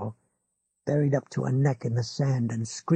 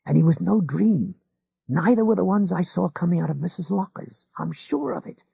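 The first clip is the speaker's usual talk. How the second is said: ordinary delivery, nothing marked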